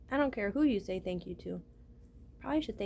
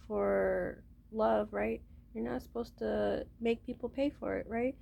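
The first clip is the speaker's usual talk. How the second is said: usual speaking voice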